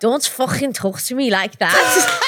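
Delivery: drawn-out amateur Scouse; Scouse accent attempt. Shown as a demonstration. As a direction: repeat it slowly in a put-on Liverpool accent. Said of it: "Try that".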